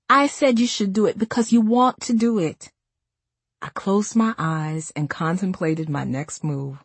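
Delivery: ordinary delivery, nothing marked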